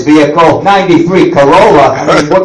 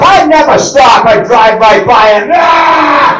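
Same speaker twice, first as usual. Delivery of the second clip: hammed-up road-rage shout; 5 semitones higher, slower than usual. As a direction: yell like a mad driver. Shouting at the other driver.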